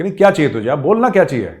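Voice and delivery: loud, pressing demand; insistent boss. Retold or imitated. Chairman pressing the narrator to name his wish, loud and insistent.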